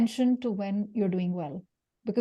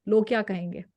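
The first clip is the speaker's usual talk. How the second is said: usual speaking voice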